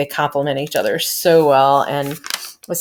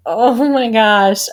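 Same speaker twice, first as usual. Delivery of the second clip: high, loud, drawn-out gush; overcome gushing; 6 semitones higher, 5 dB louder, much slower than usual. Retold or imitated. Fan retelling a tearjerker episode moment, overcome gushing. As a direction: stretch it out, overcome by sweetness. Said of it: "I started crying like a baby".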